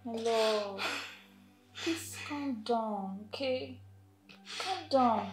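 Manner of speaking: soft, low, slow reassurance; soothing. Acted. Rose calming the robbed partner, tender and hushed. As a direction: gently talk them down.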